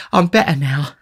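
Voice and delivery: high lady's voice after a sigh; recovering lady. A narrator's reading. Matron falls back after drinking, recovering lady. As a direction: say it high, just coming round.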